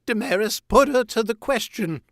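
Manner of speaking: very high, loud confession; anguished cry. A narrator's reading. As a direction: cry it out in terror of the blade. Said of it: "cried Wojin".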